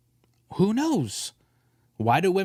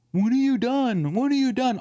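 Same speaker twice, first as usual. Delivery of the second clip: put-on nagging girlfriend; 8 semitones higher than usual.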